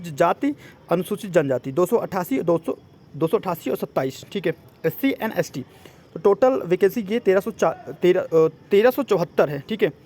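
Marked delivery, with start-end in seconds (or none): none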